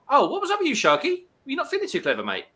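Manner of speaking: aww, poor thing; concerned, high. Real, not acted. Asking an unwell viewer what was wrong, high and sympathetic.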